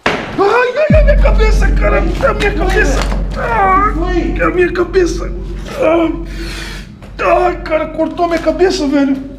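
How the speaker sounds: high, loud cries of pain; screaming in pain. Acted. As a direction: howl like your head was hit. Acted head injury, crying out.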